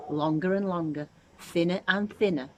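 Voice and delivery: ordinary delivery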